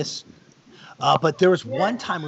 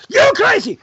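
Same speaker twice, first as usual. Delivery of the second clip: a stuntman's shouted joke line; mock yelled line; 9 dB louder than usual. Green Ranger shouting his joke line at the director, loud and high. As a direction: yell it high and loud, hammed up. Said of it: "and your line is".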